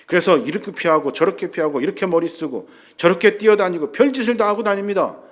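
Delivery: loud, mounting sermon list; impassioned pulpit delivery; forceful preaching. Real, not acted. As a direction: pile up each phrase with rising force.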